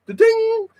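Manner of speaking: very high, loud "da-ding"; imitated alert chime. Thanking a supporter on the stream, imitated alert chime. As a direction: chime it out high like a notification bell.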